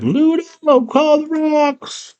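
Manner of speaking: very high, slow drawn-out command; Sarah tells Ludo to call the rocks; high girl's order. Retold or imitated. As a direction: stretch it out high like a girl giving orders. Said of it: "She's like".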